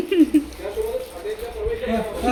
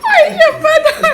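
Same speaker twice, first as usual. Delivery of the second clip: laughing disbelief; 10 semitones higher, 5 dB louder, much slower than usual. Real, not acted.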